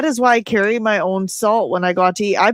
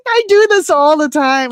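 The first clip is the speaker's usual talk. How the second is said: defensive retort; 6 semitones higher, 5 dB louder than usual. Retold or imitated. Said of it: "And I'm like".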